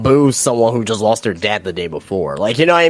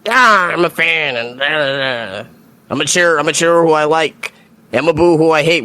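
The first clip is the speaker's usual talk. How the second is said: hammed-up, raised-pitch fan imitation; mock wrestling fan; 5 semitones higher than usual. Mimicking fans who won't think, mock fan voice. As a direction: play a simple-minded cheer-or-boo fan. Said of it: "They're just".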